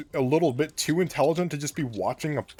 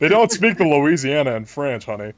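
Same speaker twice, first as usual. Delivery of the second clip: sassy; 3 semitones higher, 8 dB louder than usual. Pretend. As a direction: deliver it like a sassy diva. Sassy mocking repeat.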